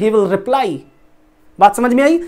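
normal talking voice

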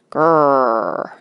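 low, drawn-out storybook growl; tiger growl. A narrator's reading. Distant tigers make a horrible noise, growling low. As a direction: growl like a tiger on one long rolled sound. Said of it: "horrible noise that sounded like".

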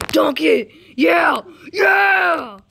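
high, loud cheering; loud game cheer; excited shouting. Real, not acted. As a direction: yell at the screen.

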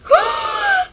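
high, drawn-in gasp; sharp high intake of breath; shocked gasp. Pretend. Shocked reaction in toy play.

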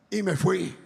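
normal talking voice

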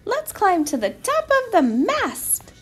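high, excited mouse voice; excited storybook mouse; eager mouse. A narrator's reading. Mouse proposes an adventure.

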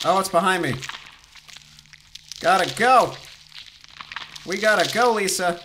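urgent panicked yelling; being chased. Real, not acted. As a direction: shout the escape in a panic. Monster chasing, urging escape.

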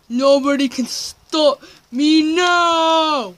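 loud, high, slow evil proclamation; triumphant villain shout. Pretend. Villain in evil lab vows conquest, triumphant drawn-out shout. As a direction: proclaim it loudly, stretching out the last word.

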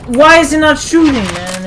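very high, loud panicked yell; real gamer panic; frantic shout. Real, not acted. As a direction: scream at the broken gun. Gun fails to fire.

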